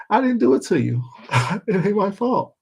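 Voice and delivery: high laughing mock protest; imitating Tracy. Imitating Tracy denying blame to the chat, laughing. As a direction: pipe up like Tracy, giggling. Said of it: "She like".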